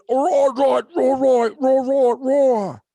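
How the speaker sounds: loud, high mock-accent gibberish; exaggerated imitation of incomprehensible British talk. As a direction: roar out unintelligible accent noises. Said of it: "I'm saying the, the fucking".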